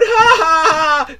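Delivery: high, loud, drawn-out fake laugh; mock guffaw. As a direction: belt out a jeering ha-ha-ha. Mock laughter at fallen pants.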